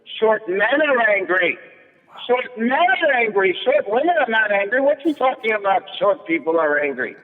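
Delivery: loud waitress arguing with her friend about short men, high and indignant. Retold or imitated. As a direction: mimic a loud, offended waitress. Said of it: "she says so loud".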